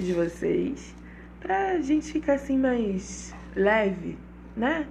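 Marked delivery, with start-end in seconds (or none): 1.4-4.9 higher, slow sultry villainess; lightly voiced soap-opera villain; telenovela villain voice. Shown as a demonstration. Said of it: "mistura de vilã de novela mexicana com atendente de telesexo".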